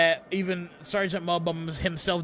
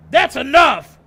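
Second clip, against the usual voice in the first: high, very loud outburst; angry yell; 8 semitones higher, 14 dB louder than usual. A narrator's reading. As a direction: yell to cut them all off. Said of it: "Sanchez yells".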